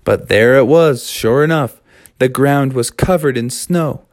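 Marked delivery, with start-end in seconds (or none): none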